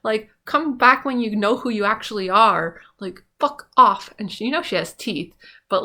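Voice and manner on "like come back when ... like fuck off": telling Glinda off. Retold or imitated. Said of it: "and you're like".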